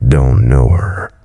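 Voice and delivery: put-on very slow, thick Southern accent; gruff Southern bar owner's drawl. A narrator's reading. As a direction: stretch it out in a sardonic Southern drawl. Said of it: "he drawled in a thick accent".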